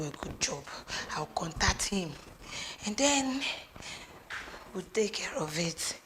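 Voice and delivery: soft, hushed whisper. Acted. Mother planning in secret with her daughter, whispering. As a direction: whisper it so no one hears.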